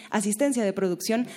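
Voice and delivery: normal talking voice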